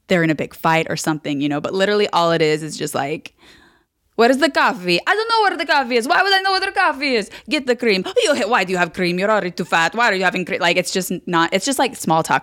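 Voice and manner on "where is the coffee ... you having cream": put-on high-pitched squabble in an Italian accent; hammed-up shrill Italian family row; mock screaming. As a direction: imitate relatives yelling, pitch shooting up then easing. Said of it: "screaming at each other".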